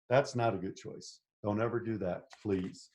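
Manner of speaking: ordinary delivery, nothing marked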